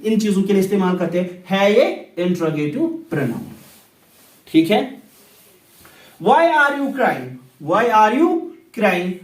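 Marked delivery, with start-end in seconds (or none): 6.2-9.1 each word of "Why are you crying?" drawn out slowly; slow demo. Shown as a demonstration. Teacher slowly models an example question for students. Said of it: "teacher demonstrates example sentence".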